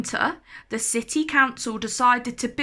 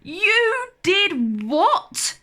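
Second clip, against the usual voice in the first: high, loud, slow outraged cry; shouted; 9 semitones higher, 5 dB louder, much slower than usual. A narrator's reading. Mum shouting at Jack over the beans, loud and shocked. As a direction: blast it out in disbelief. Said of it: "shouted his mum".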